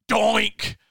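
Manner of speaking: high, loud cartoon doink; doink sound effect. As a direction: pop the doink like a ball off the post. Ball hitting the upright.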